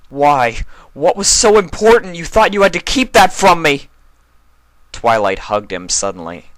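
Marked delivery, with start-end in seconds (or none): 0.1-3.9 high, loud demanding yell; angry shout. Acted. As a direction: shout the question at her. Spike angrily demands an answer.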